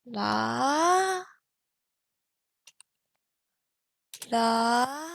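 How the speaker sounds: sung la for students to copy; model tone la. Shown as a demonstration. Teacher giving a la for students to repeat. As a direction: sing la as a model. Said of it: "repeat after me".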